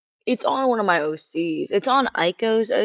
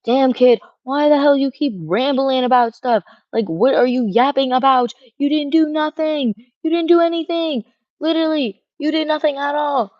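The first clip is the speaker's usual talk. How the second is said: angry retort; 6 semitones higher, 6 dB louder than usual. Retold or imitated. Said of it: "And I was like".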